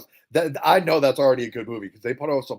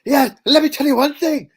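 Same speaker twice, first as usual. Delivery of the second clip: much higher, louder hammed-up movie-villain quote; villain line; 12 semitones higher, 8 dB louder than usual. Quoting McGregor's line to Gyllenhaal, hammed-up loud. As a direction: play the tough guy's threat, big and loud. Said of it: "he goes".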